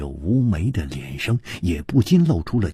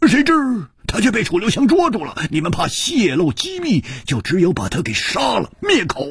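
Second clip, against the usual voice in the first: high, fast, pointed; high and fast accusation; quick conclusion; 13 semitones higher, 4 dB louder than usual. Acted. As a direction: rush to the damning end. Hu Tiehua concluding.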